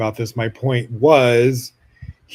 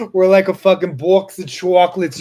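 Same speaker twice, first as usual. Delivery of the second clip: laughing through the line, higher and louder; 6 semitones higher, 6 dB louder than usual. Real, not acted. Joking about her relationship, laughing through it. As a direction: crack up while saying it.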